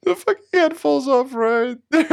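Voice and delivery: high-pitched laughing shout; laughing hard. Real, not acted. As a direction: crack up while pointing. Laughing at mannequin video.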